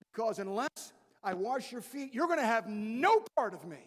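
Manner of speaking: loud, forceful threat; forceful warning. Shown as a demonstration. Preacher voicing Jesus as a loud threat, then taking it back. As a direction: bark it like a stern ultimatum. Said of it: "He didn't say it like that".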